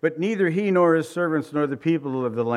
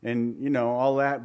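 ordinary talk

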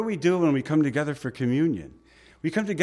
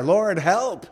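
retold cry for help, raised pitch; mock yelled plea; 5 semitones higher than usual. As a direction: cry out briefly, half in earnest. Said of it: "he yelled".

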